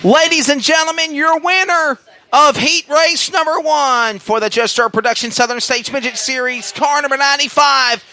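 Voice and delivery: booming pumped announcer. Real, not acted. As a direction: boom the winner's name to the crowd. Winner announcement to crowd.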